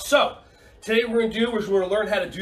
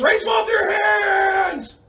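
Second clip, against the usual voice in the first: excited shout; 13 semitones higher, 6 dB louder, much slower than usual. Real, not acted. Excited call to kids.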